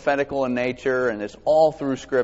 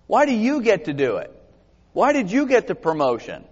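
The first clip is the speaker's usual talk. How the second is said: higher-pitched envious grumbling; put-on petty challenge, pitched up; mock resentful complaint; 6 semitones higher than usual. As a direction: imitate someone griping about another's privilege. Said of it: "you heard people talk like this".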